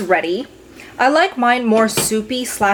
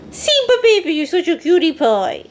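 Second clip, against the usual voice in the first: much higher cooing voice; 8 semitones higher, 4 dB louder than usual. Real, not acted. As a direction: coo at the dog with a laugh. Cooing to her pet Simba.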